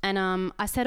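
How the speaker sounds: usual speaking voice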